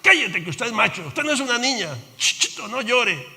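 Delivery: a stern dad telling his son to stop crying; father's mock scolding. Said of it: "nuestro papá nos decía".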